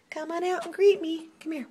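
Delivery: high coaxing. Real, not acted. Coaxing cat higher.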